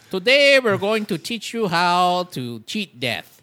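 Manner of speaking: loud, slowed master-class lecturer in a put-on accent; hammed-up teacher's accent, a little like Andy Kaufman. Mock teacher welcoming a master class on cheating death, in a hammed-up accent. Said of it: "I don't know why I did that accent".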